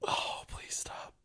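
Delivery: slow, whispered plea; pleading groan. Real, not acted. Begging co-host to drop the accent, whispered plea. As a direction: groan it out under your breath.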